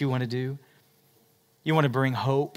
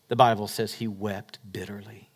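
hushed whisper; 3 semitones lower than usual. Real, not acted. Preacher whispering to the congregation of the king's bitter weeping. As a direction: whisper it, unvoiced.

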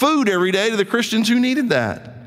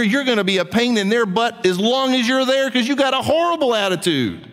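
usual speaking voice

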